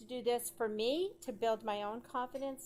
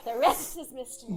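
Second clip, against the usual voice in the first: louder, laughing through it; 10 dB louder than usual. Real, not acted. Joking about meeting in a bar.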